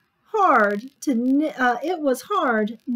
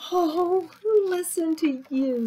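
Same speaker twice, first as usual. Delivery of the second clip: usual speaking voice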